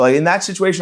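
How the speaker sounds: ordinary talk, nothing marked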